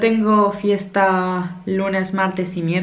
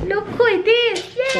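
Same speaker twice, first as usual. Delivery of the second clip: much higher, slow cooing voice. Real, not acted. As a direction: coo at the puppy slowly. Calling the dog over.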